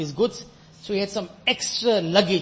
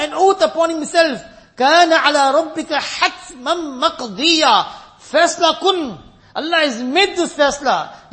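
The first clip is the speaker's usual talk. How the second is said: full-force preaching; 8 semitones higher, 9 dB louder than usual. Acted. Preacher warning the brothers of the trumpet, loud preaching. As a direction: thunder it out to the congregation.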